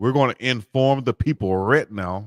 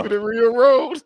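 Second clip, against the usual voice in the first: high, louder rising mock; mocking repeat; 12 semitones higher, 4 dB louder than usual. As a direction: replay his phrase in a high teasing tone. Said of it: "Hold up, rewind".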